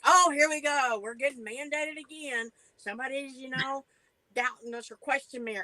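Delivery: exasperated driver complaining, voiced; mock-annoyed trucker griping; grumbling driver.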